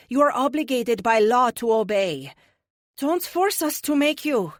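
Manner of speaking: French heroine warning with a polite edge; French accent, light soprano. A narrator's reading. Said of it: "Her light soprano and delightful French accent".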